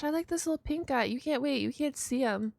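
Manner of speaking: normal talking voice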